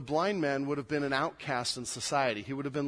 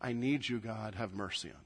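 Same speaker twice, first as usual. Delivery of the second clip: low, soft plea fading away; hushed prayer; 8 semitones lower, 10 dB quieter than usual. Acted. Praying to God for mercy, hushed. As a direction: pray it quietly, dropping almost to nothing. Voices the blind man's prayer.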